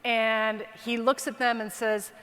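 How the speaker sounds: ordinary talk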